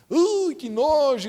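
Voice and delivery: drawn-out squeamish exclamation; acted disgust. Preacher imagining a frog in the toilet, hammed-up disgust. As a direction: recoil slowly from something revolting. Acts disgust at frogs everywhere.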